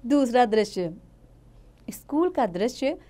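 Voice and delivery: ordinary delivery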